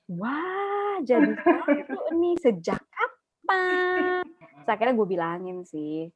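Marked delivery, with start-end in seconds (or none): 0.1-4.2 astonished outburst. Retold or imitated. Discovery of mixed laundry.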